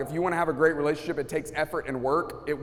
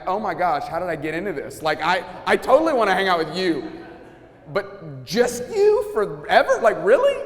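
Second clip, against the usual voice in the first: higher, louder, laughing disbelief; mock exasperation; 4 semitones higher, 6 dB louder than usual. Husband balking at spending every moment with wife, mock exasperated. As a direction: play up the dismay, laughing at the thought.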